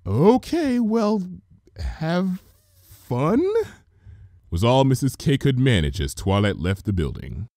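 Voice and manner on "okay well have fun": awkward Mrs Cake. Acted.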